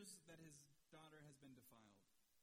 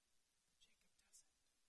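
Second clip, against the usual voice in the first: whisper; 15 dB quieter, slower than usual. Acted. Pastor stresses Jacob's failure.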